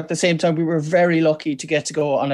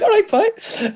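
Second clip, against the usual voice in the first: high singsong Cork accent; exaggerated Cork lilt; slower than usual. Shown as a demonstration. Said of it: "My Cork accent's getting stronger".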